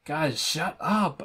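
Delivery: mild mock yell, drawn out; half-hearted yell to hush friends; slow, stretched "shut up" without real shouting; narrated yell. A narrator's reading. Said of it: "I yell as we walk fast".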